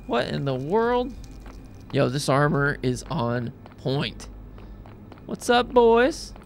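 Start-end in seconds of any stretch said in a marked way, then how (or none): 5.3-6.3 high, slow mocking hello; cocky greeting. Real, not acted. Player greeting the mobs, high cocky taunt.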